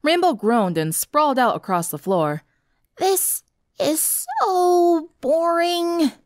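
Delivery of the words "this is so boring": very drawn-out, high whine; bored groan. A narrator's reading. Rainbow sprawled on the floor on guard duty, bored groan. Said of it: "said in a bored tone".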